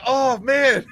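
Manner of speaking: high, loud retold gasp of awe; awed exclaim. Retelling his reaction to the villain to the co-host, high and loud awe. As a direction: relive being blown away by the villain. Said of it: "I was like".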